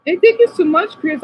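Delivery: high, loud, excited gratitude; gushing thanks. Real, not acted. Streamer thanking viewer Chris, excited. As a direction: beam your thanks out.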